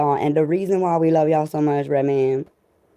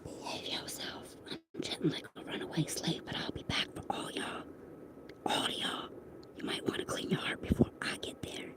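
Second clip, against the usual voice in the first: hushed, fully whispered warning; very soft, breath-only aside; 18 dB quieter than usual. Real, not acted. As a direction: whisper it like a secret threat.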